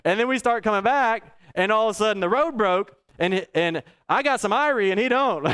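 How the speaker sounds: usual speaking voice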